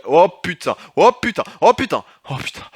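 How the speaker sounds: high, loud panicked cries; panicked swearing. Real, not acted. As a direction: blurt it out in rising panic. Panique face à un ennemi. (jurons paniqués, aigus et forts)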